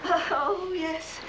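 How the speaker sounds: ordinary talk, nothing marked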